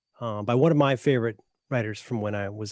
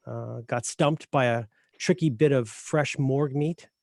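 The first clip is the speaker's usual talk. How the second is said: normal talking voice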